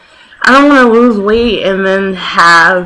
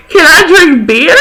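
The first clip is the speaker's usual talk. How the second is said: high, louder, whining; mock whiny plea; 9 semitones higher, 5 dB louder than usual. Mom raises her hand and pleads with the doctor, high whiny plea. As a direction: beg for it like a kid. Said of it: "and she goes".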